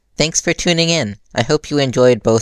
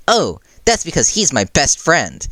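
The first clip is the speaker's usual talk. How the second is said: happy; 7 semitones higher than usual. Acted. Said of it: "Mikumo replied happily".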